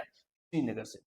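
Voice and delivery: a low, soft student reply from the back; class answering. Pretend. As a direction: answer quietly like the whole class. (धीमी आवाज़ में छात्रों का जवाब)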